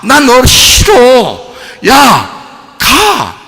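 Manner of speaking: slow, loud, high shouts; forceful acted dismissal; commanding rejection. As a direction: banish it with drawn-out force. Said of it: "이러라고요".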